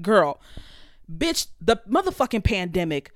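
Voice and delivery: usual speaking voice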